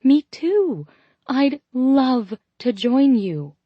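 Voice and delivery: light up with eager delight. A narrator's reading. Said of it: "eyes lighting".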